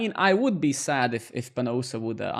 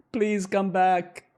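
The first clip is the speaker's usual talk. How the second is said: slow, higher, hammed-up begging; mock pleading; 4 semitones higher, much slower than usual. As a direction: pretend to beg the viewer back. Mock pleading to leaving viewer.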